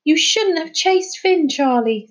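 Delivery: high, slow reproach to a friend; reproachful. Acted. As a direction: scold him for the chase. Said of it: "said Dougie reproachfully".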